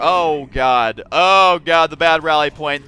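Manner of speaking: loud, real "oh god" of dismay; alarmed dismay. Real, not acted. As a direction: blurt it out loudly on spotting your blunder. Dismayed at own misplay mid-game.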